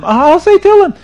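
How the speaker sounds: high, loud mock wail; crying penitent. Sinner pleads with the rabbi, hammed-up crying. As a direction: wail like a desperate, guilt-ridden man. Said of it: "the man is crying and he's screaming".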